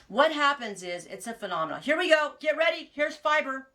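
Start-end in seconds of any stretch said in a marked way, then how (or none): 1.8-3.7 higher and louder, like a show intro; announcing her blog's name to viewers, pumped; hyped announcement. Real, not acted. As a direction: build excitement for the reveal.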